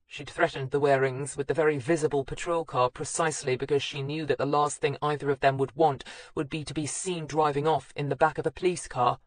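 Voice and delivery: ordinary delivery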